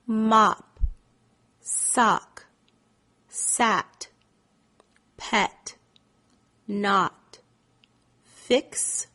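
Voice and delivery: single words, each followed by a long pause; words spaced apart. Shown as a demonstration. Teacher leaving time for the student to respond, words widely spaced. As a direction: read the list one word at a time. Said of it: "Leave time between saying the words".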